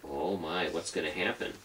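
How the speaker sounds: ordinary delivery, nothing marked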